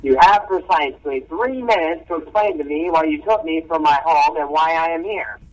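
put-on high, annoyed businesslike old man; clipped impatience, demanding answers. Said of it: "in a fairly annoyed tone".

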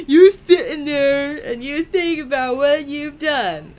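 high, loud mock-parent telling-off; laughing mock scolding. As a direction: scold playfully through laughter. Mock scolding game character, laughing.

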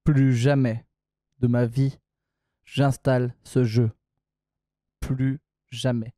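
slow, low, word by word; low, deliberate declaration of disgust; grim vow. Real, not acted. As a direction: swear it off with heavy, spaced-out finality. Vows never to install game again.

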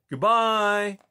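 loud farewell shout; calling goodbye. A narrator's reading. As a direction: call it across the clearing. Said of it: "cried ... as well as Rye".

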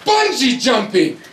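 put-on eager kid pleading with his dad; teen son voice. Son begging dad about bungee jumping, excited.

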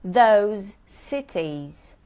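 slow, joining the v sounds; I visited those cities drawn out, v sounds joined; slow linked demo. Shown as a demonstration. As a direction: link visited and those smoothly, slowly. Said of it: "I joined two V's".

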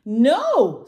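loud, high scream; screamed refusal. A narrator's reading. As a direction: scream it as a defiant little dinosaur. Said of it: "screamed Tiny".

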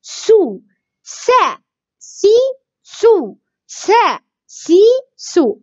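سا سي سو, each short and high; short isolated syllables. Shown as a demonstration. Teacher drills seen's three vowels, short separate syllables. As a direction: chant سا سي سو one by one with gaps. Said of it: "ضمة".

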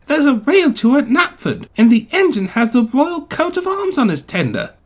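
high-pitched, urgent insistence; pleading. A narrator's reading. Thomas gives Gordon the evidence, pleading. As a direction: press the proof eagerly. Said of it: "Thomas pleaded".

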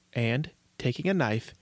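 usual speaking voice

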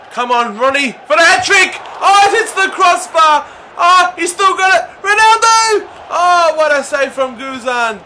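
high, loud cheering at the near miss; excited shouting. Real, not acted. Gamer yelling at his striker's shot, excited shout. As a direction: yell it like the ball's about to go in.

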